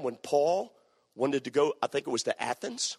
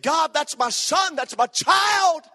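high, loud anguished cry to God; a father's desperate plea, shouted high; desperate pleading; 7 dB louder than usual. Retold or imitated. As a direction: beg God not to take the child. David begging God for son.

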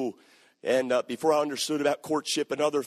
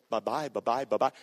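mock goodbye; 6 semitones lower than usual. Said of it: "everybody's gonna think I'm saying".

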